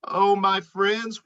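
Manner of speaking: plain reading voice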